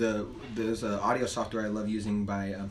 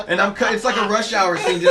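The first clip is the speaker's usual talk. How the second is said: usual speaking voice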